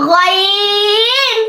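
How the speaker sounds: each letter held long, full and chanted; heavy recited letters. Shown as a demonstration. Tajweed teacher reciting heavy letters for students. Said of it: "ইস্তেলার হরফগুলো".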